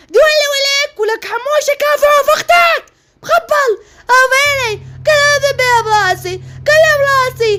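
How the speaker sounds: put-on a bratty boy bawling and tattling to mum; crying, shouting son. Son's fight with his sister.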